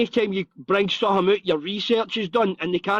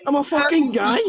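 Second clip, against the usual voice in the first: higher, appalled yelp; shocked recoil; 7 semitones higher than usual. Retold or imitated. Retelling opening a stranger's Snapchat, mock shocked. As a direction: recoil from the picture on the screen.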